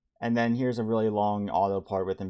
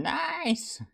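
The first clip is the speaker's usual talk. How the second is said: very high, drawn-out exclamation; playful cheer. Real, not acted. Streamer cheers that a viewer can hang out, playful.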